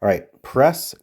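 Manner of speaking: ordinary delivery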